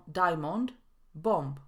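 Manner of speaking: diamond with full o, bomb with sounded final b; common mispronunciation. Retold or imitated. Teacher repeating a mistake she hears often, diamond, bomb as spelt. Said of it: "Często słyszę wymowę".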